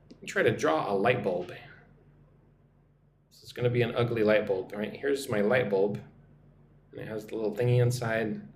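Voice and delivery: normal talking voice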